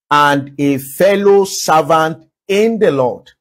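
slow, measured reading of the verse; scripture reading. Acted. Preacher reads Colossians aloud to the church, slow and measured. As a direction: read the verse slowly and deliberately.

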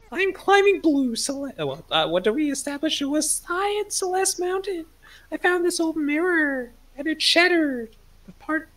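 high, dramatic storytelling voice; high character voice. Acted. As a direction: tell it high like a lost hero. Plays Celeste character telling backstory.

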